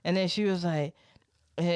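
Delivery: normal talking voice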